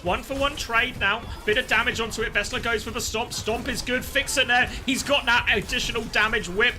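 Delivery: high, loud, urgent fight call; excited play-by-play. Acted. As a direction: build the hype with each kill.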